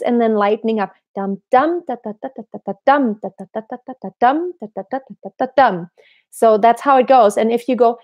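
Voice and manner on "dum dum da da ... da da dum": chanted rhythm with accents on each dum; accented groups. Shown as a demonstration. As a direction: punch each dum, lighten the da's. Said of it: "I'm grouping them. I'm making accents".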